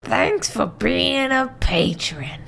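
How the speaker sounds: high, slow put-on Toad voice; character voice line. Performer auditioning for Nathan's turned chair, cartoon voice. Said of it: "I'm gonna do a voice line".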